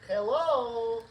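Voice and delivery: loud, high hello; calling out. Real, not acted. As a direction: call out to check the mic. Mic check calling out.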